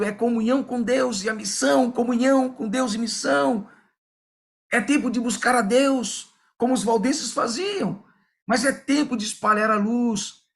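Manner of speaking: rally the room to go out and shine; preaching. Acted. Pastor urging the congregation to spread the light, preaching fervently.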